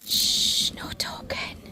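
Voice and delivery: hushed library whisper; whispered hushing. Real, not acted. Mum telling children no talking in the library, whispered. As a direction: shush them under your breath.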